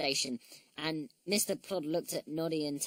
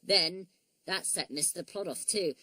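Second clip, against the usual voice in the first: plain reading voice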